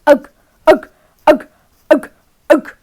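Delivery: loud straining machine groans; vacuum drone. Acted. Narrator imitates the vacuum straining, loud grunting drone. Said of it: "made an awful droning noise".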